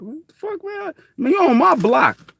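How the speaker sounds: high and loud challenge; territorial kid. Retold or imitated. A teen confronting strangers on his block, high and loud. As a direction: call out the kid on your block.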